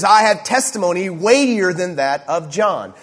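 slow, loud, high stress on "weightier"; emphatic declaration. Acted. Jesus comparing his testimony with John's, emphatic "weightier". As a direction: lean hard and slowly on "testimony" and "weightier". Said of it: "So this is emphatic, really powerful language".

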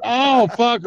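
high, loud laughing gasp of surprise; surprised. Real, not acted. Caught out by his friend's call, loud surprised laugh. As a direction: caught off guard, blurt it out. Learns friend called Artist.

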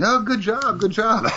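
high, far-off mock praise for a child, laughing through it; patronizing praise. Host mocking his own praise of a guest: high patronizing laugh. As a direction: pat a kid on the head with your voice. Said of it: "kinda like the kid that drew a stick figure".

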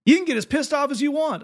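hammed-up pulpit rant; mock Tennessee preacher. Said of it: "I'm going to do the accent".

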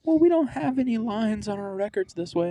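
high, mocking voice of the worker; mocking the plumbing lady. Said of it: "just showed up and was like".

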